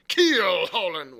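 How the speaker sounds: very loud, slow KEEL HAULING; scornful outburst. Acted. Silver throws Morgan's answer back, scornful and loud. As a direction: boom "Keel hauling" back at him, drawn out.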